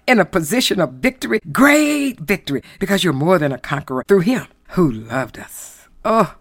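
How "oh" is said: ordinary talk, nothing marked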